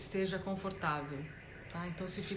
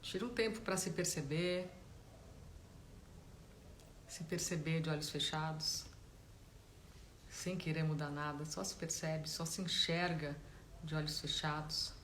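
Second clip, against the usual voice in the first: guided meditation; 7 dB quieter than usual. Real, not acted. Meditation teacher guiding listeners to notice body and breath, calm guided-meditation voice. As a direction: gently guide them inward, leaving space between phrases.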